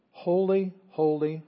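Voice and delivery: "Holy, holy" high and loud, word by word; emphatic repetition. Retold or imitated. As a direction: proclaim each "holy" separately with force. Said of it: "holiness is the only thing that's emphasis".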